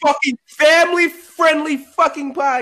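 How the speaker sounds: loud, high cursing indignation, played for laughs; mock outrage. Co-host insisting the podcast is family-friendly, mock outraged. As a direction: pretend to be scandalised, at high volume.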